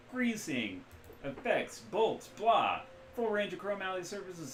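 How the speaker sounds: loud, loose Midwestern rigger; Midwestern accent. Acted. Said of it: "loose Midwestern accent".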